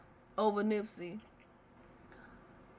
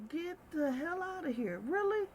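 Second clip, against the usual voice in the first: disbelief; 5 semitones higher, 6 dB quieter than usual. Retold or imitated. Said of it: "I'm like".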